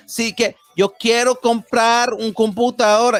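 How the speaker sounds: put-on high, slow gringo-accented Spanish; mock American accent. Putting on the American for Spanish speakers, mock American accent. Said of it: "they would do the American".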